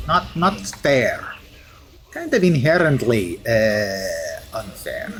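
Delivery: hammed-up fake Russian accent; deliberately bad Russian accent. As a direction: play a steel hero with a mock Russian accent. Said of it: "bad Russian accent".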